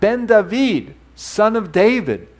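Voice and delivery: calling out, high and drawn out; imitated shout. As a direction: act out blind men crying for attention. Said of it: "and they shouted out".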